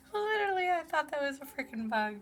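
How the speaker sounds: high, giggly astonishment; amused disbelief. Real, not acted. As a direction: laugh through the words in surprise. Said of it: "I'm totally laughing right now".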